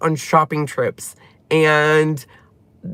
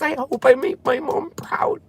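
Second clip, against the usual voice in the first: soft breathy whisper, pitched up; whispered wish; 8 semitones higher, 4 dB quieter than usual. Retold or imitated. Quietly whispering her daily hope to her late mom. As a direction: whisper it like a private prayer.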